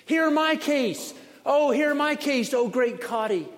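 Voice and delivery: high, loud cry from a noisy crowd; clamouring petitioners. Acted. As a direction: call out urgently to be heard over the din. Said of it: "nothing but a cacophony of voices".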